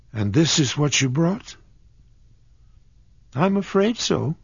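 put-on light, sheepish young girl explaining. A narrator's reading. Kismine explains the wrong drawer to John, sheepish girl. Character dialogue voiced.

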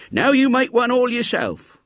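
put-on high, creaky old countryman voice; old man coaxing the boy, pitched high. A narrator's reading. Old man's voice in story.

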